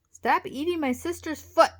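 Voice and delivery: loud, high-pitched order; shouted demand. A narrator's reading. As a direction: shout at the attacker to stop. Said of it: "Wally shouted".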